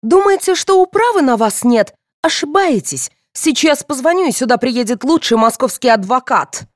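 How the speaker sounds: high-pitched angry threat; indignant shouting. A narrator's reading. Mother threatening policeman with a lawyer, shouting. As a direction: threaten him loudly, starting at full pitch. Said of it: "закричала старшая Яковлева".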